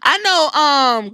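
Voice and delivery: ordinary talk, nothing marked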